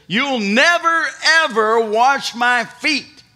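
loud, high, slowing refusal; protesting. Retold or imitated. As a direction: refuse loudly, stretching ever. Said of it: "Peter protested".

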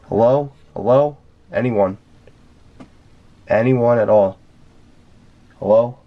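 loud rising hello into the dark; calling out. Real, not acted. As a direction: call out to whoever might answer. Calling into the silence.

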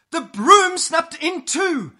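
high, loud, drawn-out narration; storyteller's shocked climax; dramatic alarm. A narrator's reading. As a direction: stretch out the disaster, high and loud. Sudden disaster in the story.

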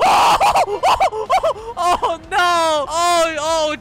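high, loud wailing cries; half-laughing mock panic wail; panicked wailing. Real, not acted. House floods with poop.

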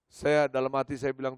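normal talking voice

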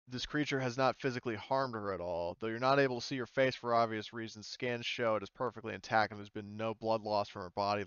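ordinary talk, nothing marked

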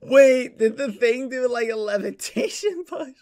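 high, squeaky-pitched talking through laughter; real helpless laughter. Real, not acted. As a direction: crack up while trying to get the words out. Cracking up at game mishap.

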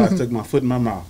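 normal talking voice

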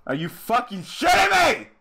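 high, loud burst of real disbelief; furious shout. Real, not acted. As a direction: explode into an angry yell. Explodes on the repeated line.

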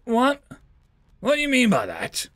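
high, sharp alien question; startled alien commander questions the prisoner; puzzled alien. Acted. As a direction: demand the meaning, thrown. Alien confused by riddle.

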